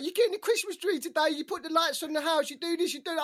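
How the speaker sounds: a hint of whiny children; nagging kids. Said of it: "I've got my kids like".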